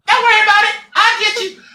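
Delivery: very high, loud squeaky threat; high-pitched voice. Retold or imitated. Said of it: "the most high-pitched voice that you've ever heard".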